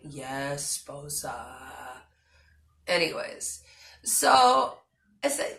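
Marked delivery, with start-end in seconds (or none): 0.0-2.0 lower, softer, drawn-out mimic of his usual reply; imitating husband. Wife mimics her husband's usual lazy reply, softer and lower. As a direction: copy his lazy habitual answer. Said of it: "Like he usually does when I ask".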